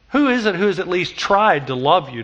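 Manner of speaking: ordinary talk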